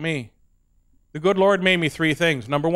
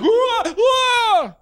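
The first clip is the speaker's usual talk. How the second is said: much higher, louder mock startled whoa; big fake fright; 4 dB louder than usual. Hammed-up startled cry for his hiding son. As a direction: overplay being scared. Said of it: "make a big deal about it".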